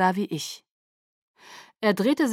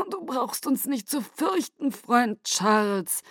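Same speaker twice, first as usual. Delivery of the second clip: high, slow, soothing elder; calm him like a frightened child; 6 semitones higher, slower than usual. A narrator's reading. Aro soothing the frightened Charles.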